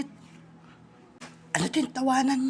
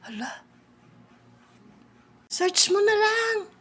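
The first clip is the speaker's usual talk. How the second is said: normal talking voice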